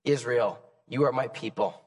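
soft, tender voice of God. Acted. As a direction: speak gently as a loving God. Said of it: "God basically said".

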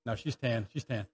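dropped low and soft, a quick self-correction; quiet aside. Real, not acted. Pastor quietly correcting himself about his wife, soft aside. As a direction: tuck it under the breath as an aside.

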